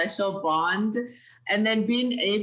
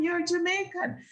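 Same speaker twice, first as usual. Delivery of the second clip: ordinary delivery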